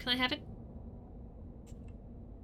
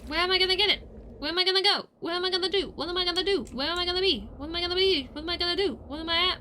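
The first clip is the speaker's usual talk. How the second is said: high panicked chant of questions; frantic spiral; 6 semitones higher than usual. Real, not acted.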